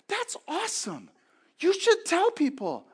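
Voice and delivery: put-on high, slow kid's voice; little son's eager voice; voicing his young son. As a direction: play the boy, wide-eyed and earnest. Son voiced in retold story.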